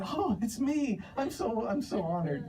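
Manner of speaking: high-pitched mock gushing; squeaky-high put-on delight, played for laughs; delighted production manager. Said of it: "but she was like".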